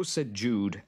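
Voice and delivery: usual speaking voice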